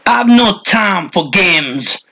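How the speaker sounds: put-on high, slow, impatient old voice; grumpy old man scolding the bushes; cranky old man. A narrator's reading. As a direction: snap it out like a fed-up hermit. Old man berating unseen person.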